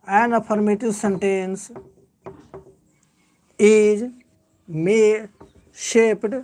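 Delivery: slow dictation with long pauses between phrases. Shown as a demonstration. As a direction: dictate word by word, leaving time to write. Dictates note to the class.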